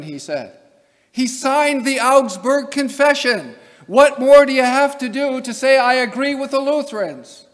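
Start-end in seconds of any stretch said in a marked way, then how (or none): 1.1-7.4 raised voice, high and emphatic; high, loud rhetorical exasperation; exasperated insistence. Real, not acted. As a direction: drive the argument home, loud and incredulous. Exasperated rhetorical argument over Calvin's view.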